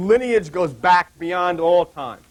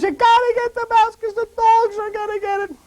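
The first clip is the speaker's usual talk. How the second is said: much higher imitation of the wife, urgent; Joan's urgent voice. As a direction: squeak out the wife's urgent order. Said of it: "Joan says".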